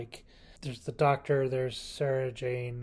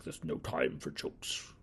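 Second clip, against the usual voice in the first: a quieter, lightly voiced stern Doctor; 10 semitones lower, 8 dB quieter than usual. The Doctor scolding Sarah Jane, lightly voiced stern. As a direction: brush off the jokes, a touch stern. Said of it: "and he's all like".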